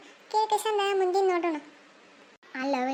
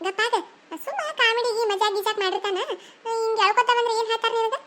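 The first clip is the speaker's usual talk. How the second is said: higher, louder, laughing sister-in-law; a higher, amused elder sister-in-law; teasing sister-in-law; 5 semitones higher, 3 dB louder than usual. Acted. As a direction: tease her, pitched up and chuckling. Sister-in-law teases Pinki.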